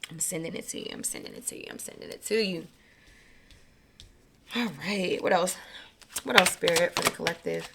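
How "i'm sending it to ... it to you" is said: soft, low-key repetition dropping to near whisper; murmured blessing. Real, not acted. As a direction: repeat it softly, like a private incantation. Repeating a blessing to the listeners.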